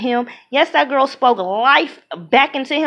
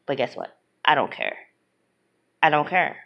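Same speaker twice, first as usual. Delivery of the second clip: lower, softer, dismissive; defiant; 8 semitones lower, 6 dB quieter than usual. Real, not acted. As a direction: shrug it off, low and quiet.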